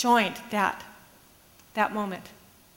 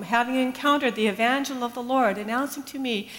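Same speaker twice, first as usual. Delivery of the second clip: normal talking voice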